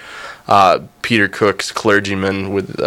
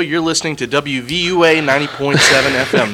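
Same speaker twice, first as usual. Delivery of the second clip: higher-pitched radio announcer patter; station ID read; 6 semitones higher than usual. Real, not acted. Host marking the fifth minute of the hour, station ID. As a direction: announce the show and station to listeners. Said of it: "[name] also has something to say".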